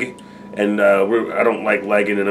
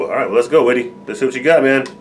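ordinary talk, nothing marked

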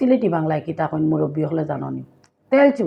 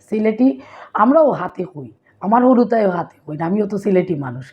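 ordinary delivery

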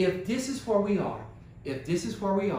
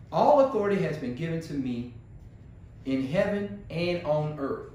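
low, measured verse reading; scripture reading; 4 semitones lower, 3 dB quieter than usual. Real, not acted. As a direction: read the verse out soberly, pitch low. Reads the verse before questioning it.

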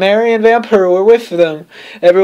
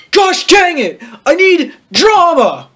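high-pitched, dragged-out mock wail; mock tantrum; 10 semitones higher, much slower than usual. Wannabe goth craving persecution, hammed-up tantrum. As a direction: whine it like a frustrated drama seeker.

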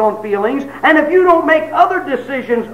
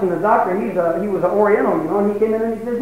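ordinary delivery

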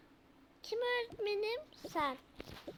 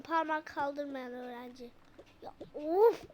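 ordinary delivery

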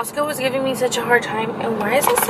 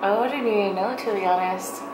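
lips around shade tabs; talking past dental sample; shade guide against teeth; 4 dB quieter than usual. Real, not acted. Seen 0: Shade tabs held against front teeth and lips while she talks.

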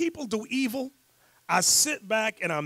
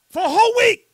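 much higher and louder, outraged; outraged exclamation; 13 semitones higher, 11 dB louder, faster than usual. Real, not acted. Host to the class about the trial, outraged exclamation. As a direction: blurt it out in outrage.

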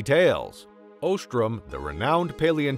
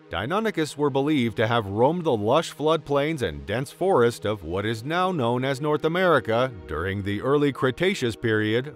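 usual speaking voice

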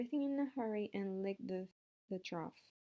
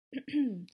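loud, deliberate throat clear; 3 semitones higher, 7 dB louder than usual. A narrator's reading. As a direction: clear your throat before an announcement. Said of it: "Wilbur cleared his throat".

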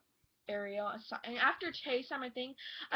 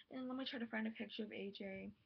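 ordinary delivery

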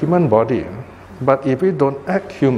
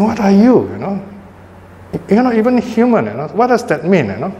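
higher-pitched imitation of a scolding mother; mock scolding; 6 semitones higher than usual. Mother questioning whether her son is human, mock scolding. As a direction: challenge the child in a lightly acted telling-off. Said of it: "So the mother is saying".

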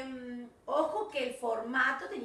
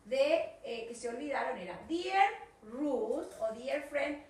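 normal talking voice

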